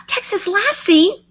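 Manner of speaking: high, surprised recognition; gasp, then say the name gladly; delighted gasp. Real, not acted. Recognising a viewer.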